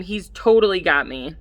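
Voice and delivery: ordinary delivery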